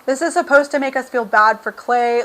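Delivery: ordinary talk, nothing marked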